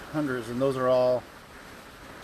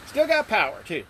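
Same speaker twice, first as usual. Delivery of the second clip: shouting over wind; 7 semitones higher than usual. Real, not acted. Signing off in the storm.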